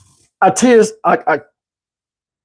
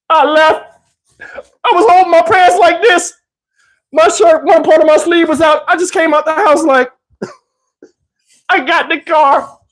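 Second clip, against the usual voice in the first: high, laughing through the words; laughing while telling; 9 semitones higher than usual. Real, not acted. Recalling leaving her house half-dressed: laughing, higher voice. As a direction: crack up as you describe stumbling out. Laughs retelling his escape.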